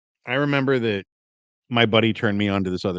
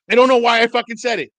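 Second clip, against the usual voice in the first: high, loud comic outburst; exasperated swearing; 11 semitones higher, 7 dB louder than usual. Retold or imitated. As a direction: yell it, leaning on the swear word.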